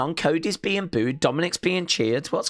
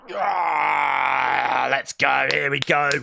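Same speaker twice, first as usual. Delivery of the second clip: ordinary talk, nothing marked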